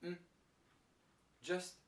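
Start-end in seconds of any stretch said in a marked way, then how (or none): 0.0-0.2 "n" as a short, weak nasal sound; reduced "and". Shown as a demonstration. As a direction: say just "n", very short and unstressed. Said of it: "changes to n".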